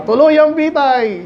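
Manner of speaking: imitating a TV host's rallying cry. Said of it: "sumisigaw pa".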